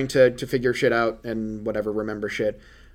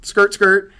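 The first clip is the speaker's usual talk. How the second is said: sound effect; 6 semitones higher, 6 dB louder than usual. Retold or imitated. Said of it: "Bamf for warping".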